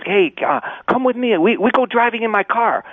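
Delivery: lightly imitating Jackie Chan's Cantonese-accented English. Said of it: "you gotta be careful with the accents".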